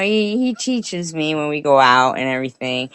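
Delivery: ordinary delivery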